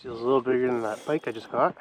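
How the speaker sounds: ordinary talk, nothing marked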